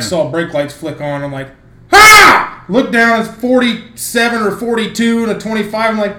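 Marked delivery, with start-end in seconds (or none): 1.9-2.6 loud, high retold yelp of alarm; startled yelp. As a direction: yelp as if just caught. Retold shock at brake lights.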